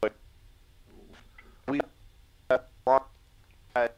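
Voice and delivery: bad connection, words breaking up; choppy voice. Real, not acted. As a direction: call audio chopping out between words. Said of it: "Let me do the choppy voice".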